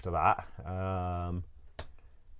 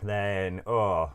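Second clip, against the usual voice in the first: normal talking voice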